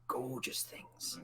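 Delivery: breathy, slow whisper. Acted. Old sailor whispering about the ships, slowly. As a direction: hush it out with awe.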